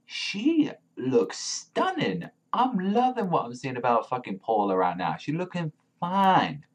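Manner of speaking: high, drawn-out gushing; gushing admiration. Real, not acted. Fan seeing Paula in this chapter, gushing. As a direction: stretch the praise out in a high swoon.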